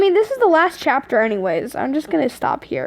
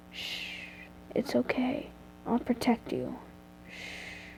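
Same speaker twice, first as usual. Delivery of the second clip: hushed soothing whisper; soft whispered shushing; 16 dB quieter than usual. A narrator's reading. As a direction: breathe it into her hair. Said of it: "he whispered into your hair".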